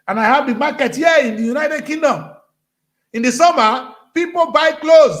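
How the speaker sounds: loud, high preacher's exhortation; fiery preaching. Acted. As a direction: rouse the congregation, full voice. Preaching rant at full force.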